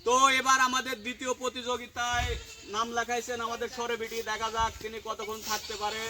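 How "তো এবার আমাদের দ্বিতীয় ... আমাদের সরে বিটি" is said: ordinary delivery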